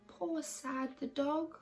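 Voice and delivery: ordinary delivery, nothing marked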